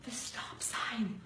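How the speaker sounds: slow urgent whisper; whispered hint. Retold or imitated. Husband quietly prompting his wife, a slow whisper. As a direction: hiss it under your breath so only she hears. Husband hinting in her ear.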